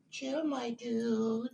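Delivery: "Chill, my dude" stretched slow and high, sing-song; high drawn-out coo. Real, not acted. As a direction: coo it long and high to settle the dog. Calming the excited dog.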